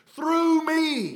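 "Through me" punched high and loud; emphasis on THROUGH ME. Shown as a demonstration. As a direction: land hard on "Through me". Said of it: "See the emphasis?".

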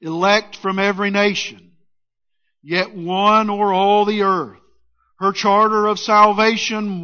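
measured, solemn hymn recital; hymn recited. Acted. Pastor recites a hymn to the congregation, measured and solemn. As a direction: recite the hymn slowly like a prayer.

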